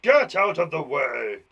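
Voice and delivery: loud, drawn-out shout; shouting man. Acted. Man clearing the way, loud slow shout. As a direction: bellow it slowly as an order. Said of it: "The man shouted".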